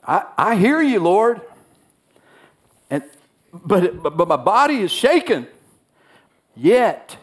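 trembling admission to the Lord; fearful confession. Acted. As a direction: voice rising in mild fear. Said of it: "And so".

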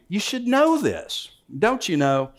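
high, slow southern drawl on the first words; playful southern preacher drawl; mock southern accent. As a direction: voice Paul with a southern twang. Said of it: "he had a southern accent".

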